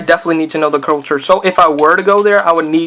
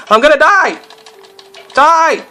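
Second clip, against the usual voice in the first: rising to a high yell; high, hammed-up game panic; panicked cry; 11 semitones higher, 3 dB louder, slower than usual. Real, not acted. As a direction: cry out that you're doomed. Panics while under attack.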